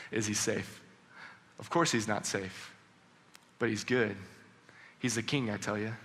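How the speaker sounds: low, hushed, knowing answer after a soft chuckle; lightly acted, low and soft storybook reply; Mister Beaver. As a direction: echo the question quietly, then assure them. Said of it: "Mister Beaver said".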